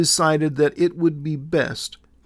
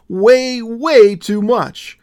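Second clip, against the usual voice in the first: WAY stretched, louder and slower; emphatic; 8 semitones higher, 8 dB louder, much slower than usual. A narrator's reading. Willow insisting to Jesus, emphatic and slow. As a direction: lean hard on Way, way, drawn out.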